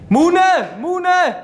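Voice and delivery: loud call across. Real, not acted. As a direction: shout the name. Calling out to friend.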